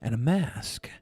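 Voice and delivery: golf commentator. Pretend. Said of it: "You sound like a golf announcer".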